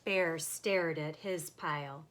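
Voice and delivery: plain reading voice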